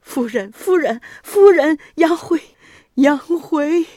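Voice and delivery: high, loud desperate calling. A narrator's reading. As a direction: cry her name out. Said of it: "呼喊着妻子的名字".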